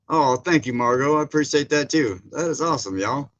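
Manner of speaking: normal talking voice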